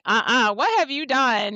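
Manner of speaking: like he's confessing a crime; suspicious alarm. Retold or imitated. Wife to husband at dinner, mock suspicious alarm. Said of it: "I was like".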